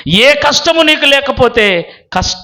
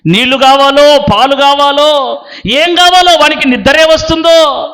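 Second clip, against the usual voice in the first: raised, insistent sermon voice; impassioned preaching; 7 semitones higher than usual. Acted. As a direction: build a driving list for the congregation. (ఆవేశంగా ప్రసంగించే స్వరం)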